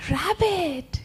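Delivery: high, drawn-out spider greeting; surprised Anansi. Acted. Anansi spots his friend rabbit, high surprised greeting. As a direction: gasp the name in a high little voice. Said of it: "And Anansi said".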